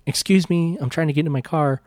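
mock Valley girl, quick finish; Valley girl accent. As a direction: put on a mild California Valley accent. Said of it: "sounded like a fucking twenty-year-old Valley girl".

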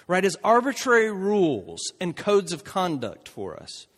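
ordinary talk

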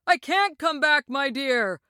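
high, distant-sounding call. A narrator's reading. Oz answers from the rising basket, calling. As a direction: call down from far away. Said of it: "called Oz from the basket".

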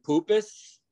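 "Poopus" for Pappas, poo-pus; mispronounced Poopus. Retold or imitated. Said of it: "you got, uh".

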